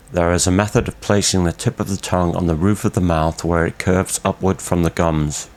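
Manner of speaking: ordinary talk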